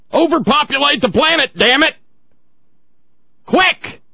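high, loud hammed-up command; mock zealot cry. Host mocks right-wing zealots with a high, loud hammed-up cry. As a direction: bark it like a frantic zealot.

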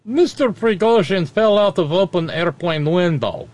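put-on reading a chat line in a Russian accent; thick Russian accent, deadpan. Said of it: "in the Russian accent".

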